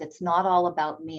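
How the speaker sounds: ordinary talk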